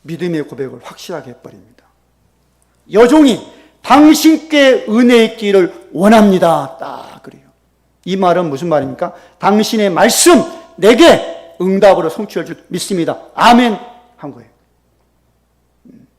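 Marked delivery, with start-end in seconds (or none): 2.9-6.8 loud, raised, sure reply; bold confession. Retold or imitated. Hannah answering the priest Eli, loud confident confession. 9.4-13.8 loud declaration. Retold or imitated. Hannah's faith declaration.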